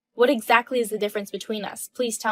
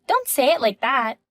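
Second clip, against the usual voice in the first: ordinary delivery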